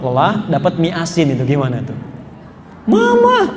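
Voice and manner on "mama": high, drawn-out cry of "Mama"; indignant call. Acted. Husband tasting salty noodles calls his wife, indignant high yell. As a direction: call out sharply on a high held note.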